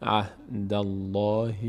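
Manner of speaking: "الله" recited long with a thick L; full, heavy Allah. Shown as a demonstration. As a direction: chant "عهد الله" slowly with a full, heavy L in "الله".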